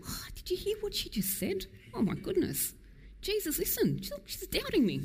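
high, soft, gossiping falsetto; mock a scandalised gossip; gossipy mock God. Preacher mocking an offended, gossiping God in falsetto. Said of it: "He's not sitting there going".

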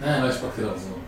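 ordinary talk

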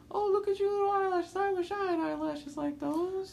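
high-pitched mock old lady; jealous old woman. Old women admiring a child's eyelashes, high mocking voice. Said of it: "old women were always jealous of me".

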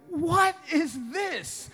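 high, slow, incredulous question; mock amazement. Newlywed husband seeing his wife's cooking, mock amazement. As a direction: draw it out in comic disbelief. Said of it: "I was amazed".

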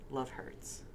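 the narrator whispering at the stars; whispered. A narrator's reading. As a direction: breathe it out like a last secret. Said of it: "and whispered".

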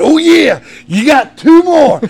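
high, drawn-out, booming retort; overruling shout. Retold or imitated. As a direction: yell the boy down like a relentless dad. Father overrules screaming son.

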